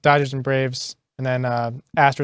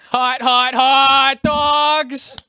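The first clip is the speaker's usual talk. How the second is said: stadium hot dog vendor call; 10 semitones higher, much slower than usual. Pretend. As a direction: bellow it like a ballpark hawker. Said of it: "with a heavy, thick New York accent".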